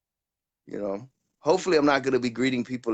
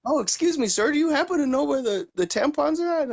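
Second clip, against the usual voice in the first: much higher mock female voice; lady shopper; 12 semitones higher than usual. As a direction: ask sweetly in a lady's falsetto. Voicing woman shopper in Target.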